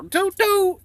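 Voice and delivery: high mock train whistle, toot toot; playful train-whistle imitation.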